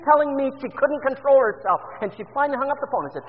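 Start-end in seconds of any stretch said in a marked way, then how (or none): none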